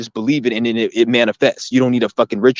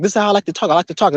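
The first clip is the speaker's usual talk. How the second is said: higher, insistent comeback to mom; defiant retort; 7 semitones higher, faster than usual. Retold or imitated. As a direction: insist you like to talk. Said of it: "I was like".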